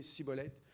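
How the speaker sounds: "cibolette" opened with a plain s; s instead of ch. Shown as a demonstration. Preacher retells the tribe's failed attempts, "cibolette" with s. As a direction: make the first sound of "cibolette" an s, not sh. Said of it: "ils disaient cibolette".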